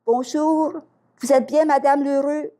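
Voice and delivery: high-pitched phone caller voice; Nina. Retold or imitated. Nina phones Madame Lheureux at home, imitated high caller voice. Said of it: "Ici Nina de Rêve de Vie Canada".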